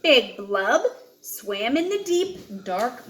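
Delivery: usual speaking voice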